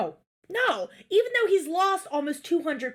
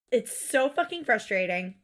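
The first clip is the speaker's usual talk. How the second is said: usual speaking voice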